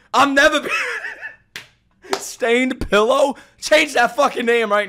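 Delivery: loud, high disbelieving shouts; real shocked hollering, high and loud; shocked yelling. Real, not acted. Shocked at respawn twist.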